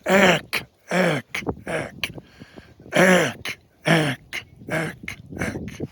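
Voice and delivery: tongue-driven ack notes into the call; ack, ack pushed with the tongue; duck call honks. Shown as a demonstration. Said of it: "you can hear my tongue going in and out".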